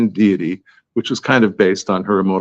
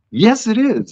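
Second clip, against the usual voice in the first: high-pitched mock comeback; retold insistence; 12 semitones higher, slower than usual. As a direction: argue back, re-enacting the spat. Acted argument, insisting back.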